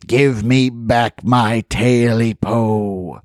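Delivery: slow eerie creature growl. Acted. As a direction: drag it out like a menacing beast. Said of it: "it growled".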